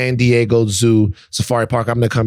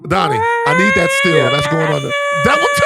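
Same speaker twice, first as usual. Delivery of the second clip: ordinary talk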